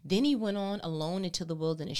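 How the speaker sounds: ordinary talk, nothing marked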